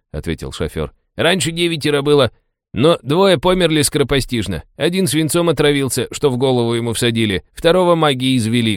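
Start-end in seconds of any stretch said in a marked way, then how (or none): 1.2-8.8 high-pitched driver voice, explaining; chatty taxi driver, informative. Acted. Taxi driver explains the local bosses to passengers, chatty and high. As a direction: play a talkative cabbie in a raised voice.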